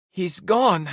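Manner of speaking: high, loud tearful cry; sobbing cry. A narrator's reading. Em grieving her host dad, loud tearful cry. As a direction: Em cries it out, breaking. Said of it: "she sobbed".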